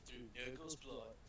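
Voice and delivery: a hint of a grim prophet; lightly voiced solemn intoning; epic fantasy narrator. Grimdark narrator riff.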